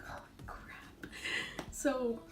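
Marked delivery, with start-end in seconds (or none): 0.1-1.1 hushed "oh crap"; whispered dismay. Retold or imitated. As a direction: breathe it out in dismay. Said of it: "whispered reaction".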